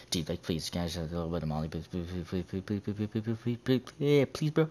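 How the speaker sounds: rapid-fire mock pleading, rising as it goes; soft, frantic stream of begging; desperate begging. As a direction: gabble the pleases like a fiend begging. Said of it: "he's, like, fiending his cock off".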